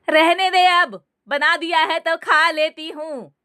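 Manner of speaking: high, loud grudging concession; grudging mother-in-law. A narrator's reading. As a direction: give in, still sounding put out. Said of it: "सास ने कहा".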